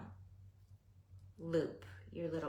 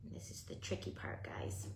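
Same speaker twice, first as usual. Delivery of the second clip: whispering; 7 dB quieter than usual. Real, not acted. Concentrating on tricky craft step.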